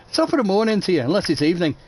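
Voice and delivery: high, hammed-up Irish greeting; a cheeky stage-Irish Paddy voice; stage Irish accent. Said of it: "I'm gonna sound like Paddy".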